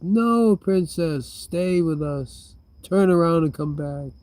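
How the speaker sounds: high, drawn-out playful plea; mock pleading. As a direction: call the princess back in pretend distress. Playful plea to an escaping princess.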